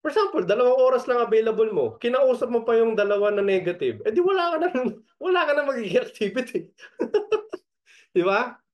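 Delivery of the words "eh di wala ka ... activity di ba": high, fast, laughing through it. Real, not acted. Coach laughing while teaching part-timers. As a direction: giggle the words out.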